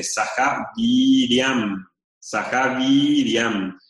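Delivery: "Viriam" with the i held long, twice the length. Shown as a demonstration. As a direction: stretch the i in "Saha Viriam". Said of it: "La I es larga". ("Saha Viriam" con la i larga, de doble duración)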